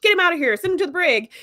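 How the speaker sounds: imitating an outraged gang; mock indignant crew. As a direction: shout down the dissenter, half in jest. Acting out crew turning on Jake.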